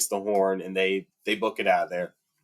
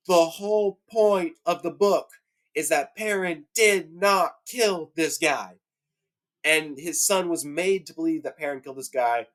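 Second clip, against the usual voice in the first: fired up; 7 semitones higher than usual. Real, not acted. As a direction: hammer out each word, pitched well up. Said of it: "I'm really fired up about this".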